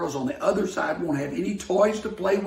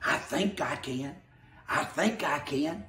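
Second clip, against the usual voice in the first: softly muttered, just starting to climb; quiet start; 3 semitones lower, 8 dB quieter than usual. Acted. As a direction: begin under your breath, straining. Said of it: "said the little blue engine".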